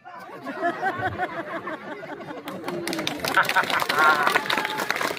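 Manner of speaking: high, long burst of laughter; real laughter. Real, not acted. Announcer laughing as the boy escapes the bird. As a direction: laugh out in relief. Laughing after the bird's pass.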